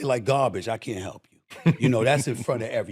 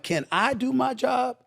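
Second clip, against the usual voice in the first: mock plea to a player; 6 semitones higher than usual. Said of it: "I'm like".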